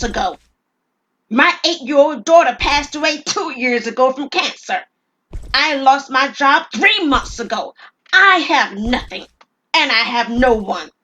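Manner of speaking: loud, high mocking cheer turning to a slow bitter outpouring; bitter sarcastic rant. Robber mocking the clerk's question then pouring out his losses, loud. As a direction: rage at his own rotten luck.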